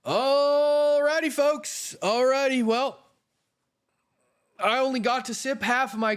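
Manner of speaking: sing-song wrap-up. Acted. Streamer calls out to chat before signing off, sing-song high. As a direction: stretch Alrighty out high like a cheery call.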